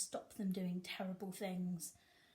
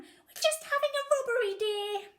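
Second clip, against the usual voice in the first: put-on high, sweet old granny voice, much higher; criminal gran; 14 semitones higher, 8 dB louder than usual. A narrator's reading. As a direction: innocent little old lady confessing. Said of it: "said the criminal gran".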